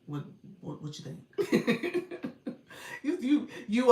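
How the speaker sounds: ordinary talk, nothing marked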